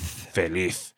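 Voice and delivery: "Feliz" low and soft, ending in TH; Spain pronunciation. Shown as a demonstration. Teacher repeating Spain's version, final TH in "Feliz". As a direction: soften the last sound of "Feliz" to TH. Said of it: "in Spain, we would say".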